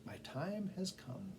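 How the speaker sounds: lightly voiced scheming cat; sly cat. A narrator's reading. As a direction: let the cat savour his plan. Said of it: "said the cat".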